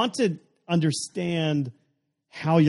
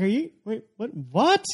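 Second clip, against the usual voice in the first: high, startled what what; acting stunned at the price; shocked disbelief; 8 semitones higher than usual. Retold shock at couch tax.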